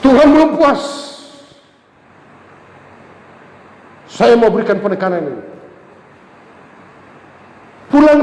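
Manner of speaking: high, loud emphatic preaching; impassioned preaching. Acted. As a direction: hammer the point home, high and loud. Preaching emphasis, closing appeal. (khotbah tegas, suara tinggi dan keras)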